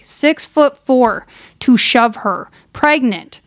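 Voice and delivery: slow, weighty emphasis; slow emphatic. Real, not acted. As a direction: spell out each word.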